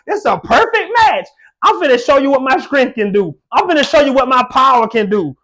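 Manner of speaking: high, loud, rising preacher's fervor; preaching at full force. Acted. Preacher to congregation, voicing God's promise, at full force.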